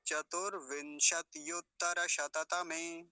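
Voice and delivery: slow, joined, every vowel full; measured Sanskrit recitation for pupils; model pronunciation. Shown as a demonstration. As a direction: say the whole compound slowly and carefully. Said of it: "ऐसा इसका उच्चारण होगा".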